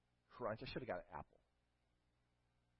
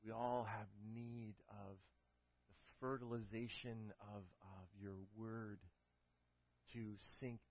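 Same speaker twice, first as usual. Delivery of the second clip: closing prayer; 2 semitones lower, 4 dB quieter than usual. Acted. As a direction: pray it gently, slowing toward the end.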